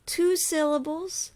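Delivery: ordinary talk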